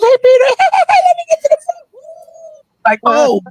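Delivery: very high, giddy mock falsetto. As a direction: squeal it like a giddy friend. Mocking Spider-Man friends on bicycles.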